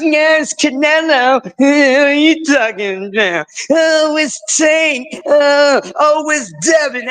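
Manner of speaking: high, excited mock fan voice. Host mimicking boxing fans naming favorites: hammed-up high fan voice. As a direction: ham up the gushing fans. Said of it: "Everybody's crying about".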